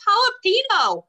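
high, loud whimpered jalapeno; imitating crying boy. Crying boy naming the jalapeno, high and loud. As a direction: squeak it out like a hurt kid. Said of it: "He goes".

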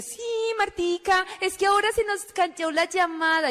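high singsong excuse; gushing caller. Retold or imitated. Recruiter explains the dropped call, high and gushing. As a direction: chirp it sweetly, imitating the recruiter.

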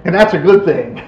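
normal talking voice

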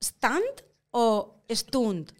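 "¿Stunt o stunt?" said very slowly, each word set apart; two pronunciations. Shown as a demonstration. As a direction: spell out both versions of "stunt" slowly for the guest. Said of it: "¿Tú cómo pronunciarías?".

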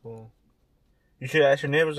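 ordinary talk, nothing marked

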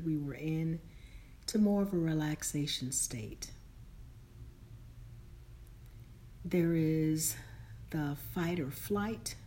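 soft, calm meditation guide voice; slow and soothing, lead them inward; guided meditation. Acted.

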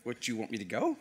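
soft, low puzzled question; taken-aback guest. Retold or imitated. Stranger finally realizing he should leave, softly surprised. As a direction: let it drop quietly. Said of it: "he's looking at me like".

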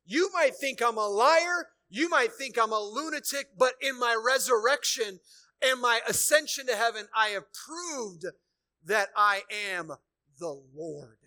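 loud, high proclamation, hammering each phrase; triumphant preaching. Acted. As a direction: proclaim the victory to the back row.

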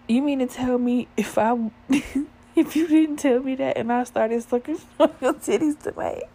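high, loud disbelief broken by laughs; incredulous laughter. Retold or imitated. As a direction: act out disbelief while cracking up. Retold disbelief, cracking up.

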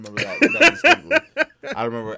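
laughing through the words. Real, not acted. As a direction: giggle while admitting it. Laughing at his own answer.

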